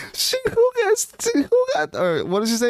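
mocking Melissa. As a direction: squeal it like a jealous rival. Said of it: "She was like".

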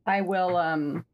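ordinary talk, nothing marked

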